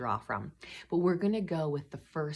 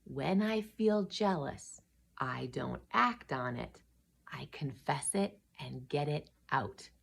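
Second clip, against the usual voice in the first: slow, deliberate, softer recital; memorable takeaway; 7 dB quieter than usual. Shown as a demonstration. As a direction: state it slowly as a lesson to remember. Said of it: "our gold nugget is this".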